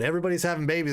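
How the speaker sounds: usual speaking voice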